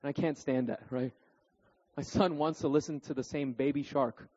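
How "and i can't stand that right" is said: ordinary talk, nothing marked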